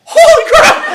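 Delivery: very high, very loud comic exclamation; astonished shout. Retold or imitated. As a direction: yell it out in mock amazement. Retold shock at the Bush voice.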